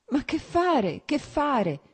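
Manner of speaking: helpless, pitiful plea pitched high; a man lost for words, pleading; a husband begging pitifully, at a loss. A narrator's reading. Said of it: "Diceva lui con voce pietosa".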